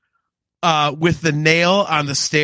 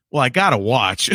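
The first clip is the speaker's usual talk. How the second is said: Santa's deadpan aside; 6 semitones lower than usual. Retold or imitated. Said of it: "and he goes".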